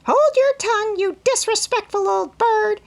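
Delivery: very high, loud parrot squawk of the same words; mocking bird echo; parrot mimicry. A narrator's reading. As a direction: parrot it back in a screech. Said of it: "cried Polly".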